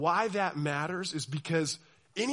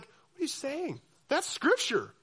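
higher, puzzled peasant voice; baffled churchgoer; 8 semitones higher, slower than usual. Retold or imitated. Medieval churchgoer reacting to Latin, higher and baffled. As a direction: sound lost and confused. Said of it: "They're like".

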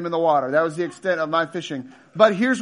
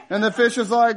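ordinary delivery

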